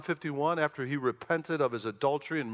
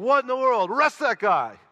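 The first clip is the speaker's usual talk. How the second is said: shocked exclamation; 9 semitones higher, 7 dB louder than usual. Retold or imitated. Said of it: "I'm going".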